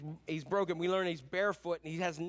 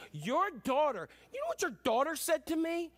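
much higher, indignant complaint; indignant spouse; 9 semitones higher than usual. Retold or imitated. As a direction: play the outraged partner in a quarrel. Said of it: "you ever get into an argument".

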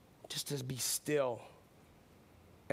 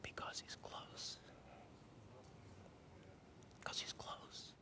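whispered; 4 semitones lower, 18 dB quieter, slower than usual. Shown as a demonstration. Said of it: "Why does God come in a whisper?".

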